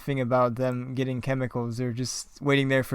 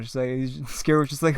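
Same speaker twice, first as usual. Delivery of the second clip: usual speaking voice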